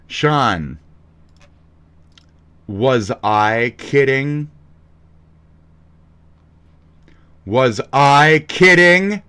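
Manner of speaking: drawn-out mock confrontation; mock dramatic call-out. Streamer calling out a silent Sean on stream, mock outrage.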